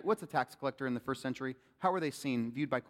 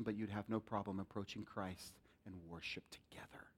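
whisper; 8 semitones lower, 14 dB quieter than usual. Acted. Whispered aside in sermon.

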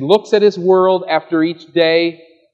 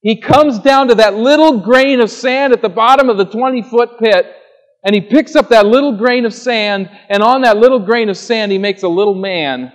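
higher, louder storytelling sweep; impassioned preaching; 9 semitones higher, 8 dB louder than usual. Acted. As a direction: paint the grain of sand with wonder. Vivid image of God's care.

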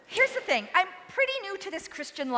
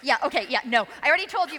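ordinary talk, nothing marked

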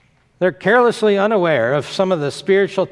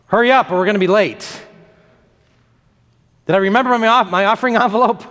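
higher-pitched worried fretting, played lightly; mock flustered; 4 semitones higher, faster than usual. Churchgoer running late for church, mock flustered. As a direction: fret like a harried churchgoer.

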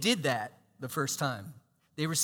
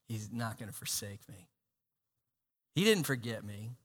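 much lower and softer, hushed assurance; quiet confidence; 8 semitones lower, 8 dB quieter than usual. Retold or imitated. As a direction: say it softly to yourself. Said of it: "And you're like".

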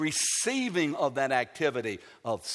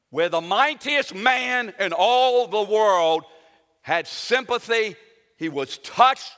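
loud, raised preacher's proclamation; full-force preaching; 5 semitones higher, 10 dB louder than usual. Acted. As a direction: drive each key word home from the pulpit.

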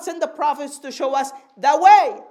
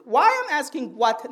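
usual speaking voice

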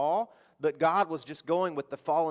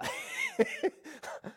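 high laugh; 4 dB quieter than usual. Real, not acted. Audience flops, he laughs.

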